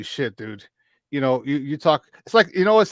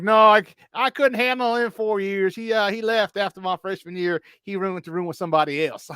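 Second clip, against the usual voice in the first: put-on North Carolina coach accent; 6 semitones higher than usual. Coach explains losing his roommate, North Carolina accent. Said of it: "he talks like this".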